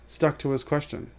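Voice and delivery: ordinary delivery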